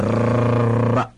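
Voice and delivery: ra with a trilled tongue-tip r; ra with a proper rolled r. Shown as a demonstration. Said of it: "一定要弹舌".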